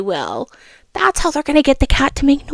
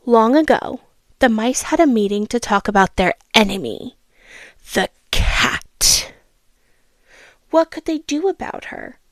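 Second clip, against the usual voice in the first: storybook reading voice; a child reading a fable aloud; story narration. A narrator's reading. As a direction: read it like a bedtime story. Reads third fable.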